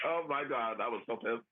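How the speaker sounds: ordinary talk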